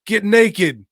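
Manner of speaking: high, loud barked order; hammed-up thug command; mock thug yell. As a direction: yell it like a thug making a threat. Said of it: "and say".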